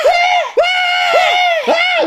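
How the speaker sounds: piercing high shriek of horror; horrified shriek. Acted. Parent shrieking at the son's grades. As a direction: shriek at the report card like a disaster.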